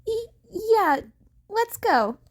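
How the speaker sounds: high, nervy stammered agreement; nervous stammer. A narrator's reading. Izuku nervously agreeing to join Mirko. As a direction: squeak out a yes, trying to sound sure.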